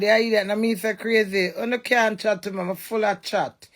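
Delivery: usual speaking voice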